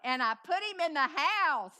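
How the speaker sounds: big rousing storyteller finish; triumphant proclamation. Real, not acted. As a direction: declare it to the whole hall.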